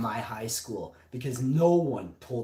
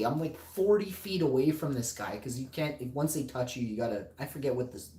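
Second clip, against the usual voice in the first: ordinary talk, nothing marked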